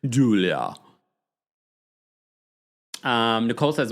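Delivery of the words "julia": quick burst of mock-Russian accent; Russian-ish accent. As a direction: say the name in a put-on Russian accent. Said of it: "Russian something accent".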